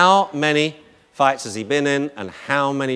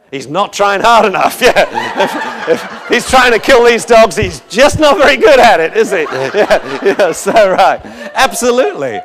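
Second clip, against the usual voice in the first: laughing it out, much higher and louder; 9 semitones higher, 12 dB louder than usual. Real, not acted. Lecturer laughing with the audience at the clumsy dog, real laugh. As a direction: crack up at the joke while talking.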